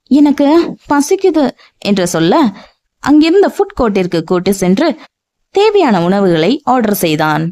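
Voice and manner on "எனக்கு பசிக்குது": put-on childlike whine. A narrator's reading. Childlike woman voiced.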